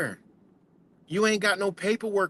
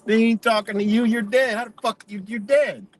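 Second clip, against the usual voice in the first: higher and pushing, words stretched out; heated insistence; 6 semitones higher than usual. Real, not acted. Warning viewers they're dead to the law, heated and insistent. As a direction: press the point harder and harder. Rant peak after a laugh.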